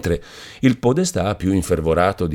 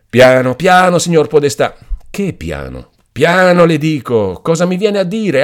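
much higher and louder, shouting down; heated shout; 8 semitones higher, 5 dB louder than usual. Acted. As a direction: stop him with a loud rebuke. Count shouting down the mayor.

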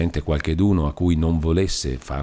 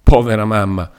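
exclaiming; 3 semitones higher, 7 dB louder than usual. A narrator's reading. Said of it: "esclamò Lucia".

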